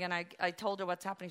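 ordinary talk

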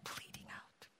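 hushed whisper. Real, not acted. Preacher to congregation about the cross, whispered. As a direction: breathe the words out, almost silent. Repeats crucifixion detail in hush.

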